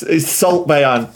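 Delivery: normal talking voice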